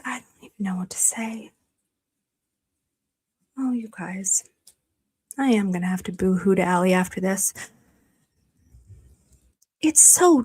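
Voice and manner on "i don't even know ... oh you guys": breathless whisper of disbelief; barely voiced, too moved to speak up; overwhelmed whisper. Real, not acted. Overwhelmed by fans' donation.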